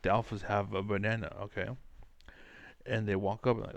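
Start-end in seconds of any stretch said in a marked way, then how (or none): none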